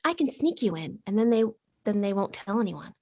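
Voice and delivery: ordinary talk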